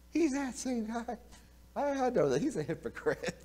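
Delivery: a hint of a sneering servant girl; mocking maid.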